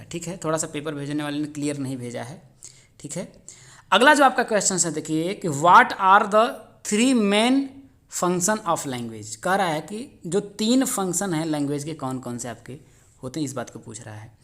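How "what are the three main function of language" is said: word by word, very slow reading; slow dictation. Shown as a demonstration. Teacher reading the exam question to students, very slow dictation. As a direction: dictate it so students can write.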